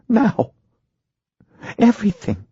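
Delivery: high-pitched wife pressing her husband; insistent wife. A narrator's reading. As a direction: insist word by word in the wife's high voice. Wife insists on hearing all.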